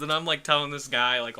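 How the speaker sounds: usual speaking voice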